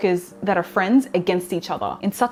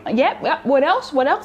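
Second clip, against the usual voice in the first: higher-pitched eager prompting; 6 semitones higher than usual. Acted. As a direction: lightly act the keen note-taker. Acting out her eager meeting self.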